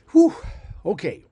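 ordinary talk

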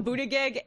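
Buttigieg said as BOO-duh-geg; imitated mispronunciation. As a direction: mimic someone botching Buttigieg with hard g sounds. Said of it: "You go Buttigieg every time".

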